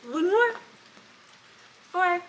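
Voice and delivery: high-pitched pet talk; short, high encouragements to a dog; coaxing the dog. Real, not acted. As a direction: pitch it well up to coax a pet along. Coaxing dog while wiping feet.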